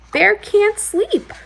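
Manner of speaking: high, slow wide-eyed twist; surprised. A narrator's reading. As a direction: reveal it with wonder.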